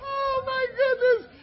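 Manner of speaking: high, slow, laughing near tears; laugh-cry exclaim. Real, not acted. Preacher overcome with laughter at his own story, laughing. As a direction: cry out helplessly amused. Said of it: "laughing at memory".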